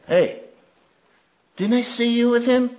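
lightly voiced girl, high and soft; a hint of a curious little girl. Little girl questioning Peter, high and soft. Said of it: "One little girl says".

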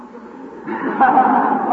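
loud high hearty fake laugh; big forced laugh. Shown as a demonstration. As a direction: lead the room in laughing.

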